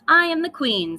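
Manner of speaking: high, loud queenly claim; royal declaration. Acted. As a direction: proclaim it like a queen. Lily declares herself queen.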